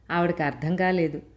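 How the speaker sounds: plain reading voice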